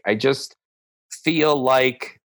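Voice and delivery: ordinary talk, nothing marked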